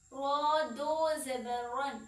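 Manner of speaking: "रॉ दो ज़बर रन" read slowly with a full, heavy "रॉ". Shown as a demonstration. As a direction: sound the "रॉ" as a full, heavy letter. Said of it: "रॉ पे थोड़ा सा हम रुकते हैं".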